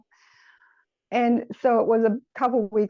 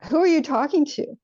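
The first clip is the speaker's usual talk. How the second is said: raised, higher mock-upset demand; retold upset mother; 6 semitones higher, 3 dB louder than usual. Mother questioning her small child, mildly acted upset. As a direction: imitate an upset parent demanding an answer. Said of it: "she got really upset".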